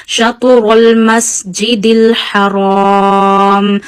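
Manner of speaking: Shatrul masjidil haram chanted very slowly, ra thick and heavy; slow measured tajweed chant with a thick ra; Quran recitation. Shown as a demonstration. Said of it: "you read ra with a thick voice".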